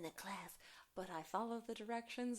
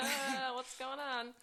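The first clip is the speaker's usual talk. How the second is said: slow, higher dazed voice with a laugh; mock confusion; 4 semitones higher, slower than usual. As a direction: play someone waking up bewildered. Voicing friend waking up confused.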